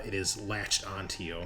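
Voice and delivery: ordinary talk, nothing marked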